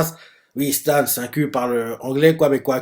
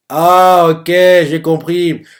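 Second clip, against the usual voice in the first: sudden realization; 4 semitones higher, 10 dB louder, much slower than usual. Real, not acted. Sudden realization while watching.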